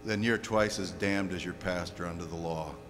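very low, softened preaching; grave hushed rebuke. Real, not acted. Preacher turning on listeners who blame their pastor, grave and hushed. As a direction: drop right down and warn them quietly.

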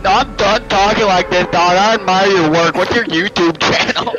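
loud, hammed-up imitation of the kid; kid dropping the act. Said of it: "he was like".

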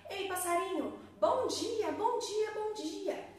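high, bright greeting snake. A narrator's reading. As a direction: sing out a cheerful good morning. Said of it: "ela falava".

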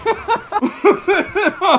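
shrieking laugh; high hysterical laugh. Real, not acted.

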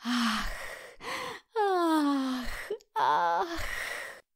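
long, soft, blissful "ach"; delighted sighs. A narrator's reading. Mole takes in the river's beauty, sighing with delight. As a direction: sigh it out in wonder. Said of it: "westchnął z zachwytu".

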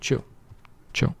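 normal talking voice

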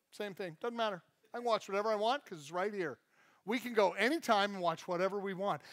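ordinary talk, nothing marked

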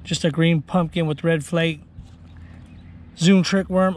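ordinary delivery

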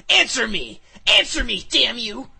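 high, loud enraged yelling; furious shout. Acted. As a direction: scream it into his face. Slamming dragon into wall, interrogating.

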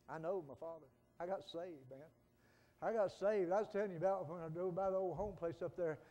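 ordinary talk, nothing marked

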